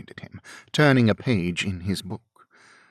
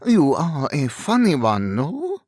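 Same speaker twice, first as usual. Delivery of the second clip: put-on louder, lilting French-accented robber, rising at the end; amused French accent; 6 semitones higher, 5 dB louder than usual. A narrator's reading. French-accented robber chuckles at Darcy's defiance, amused. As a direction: tease him in a thick French accent. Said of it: "The man chuckled".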